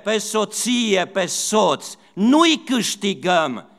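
high, loud preacher's cry, slowing to hammer it home; fervent pulpit preaching, voice lifted high and loud; preaching at full force. Acted. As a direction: rouse the congregation like a revival preacher. Preaching burst, raised voice.

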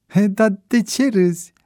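mildly self-conscious protest; embarrassed. A narrator's reading. Bebe answers teasing about his girl, embarrassed. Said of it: "replică el jenat".

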